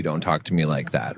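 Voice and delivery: low, soft, on-guard warning; quiet defensive demand. Shown as a demonstration. Husband to wife, a low, guarded push-back. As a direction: sound cornered as you say it. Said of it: "makes me come across as weaker".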